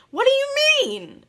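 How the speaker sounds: mock-shocked mom shrieking the question. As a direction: squeal it in disbelief. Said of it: "Michelle was like".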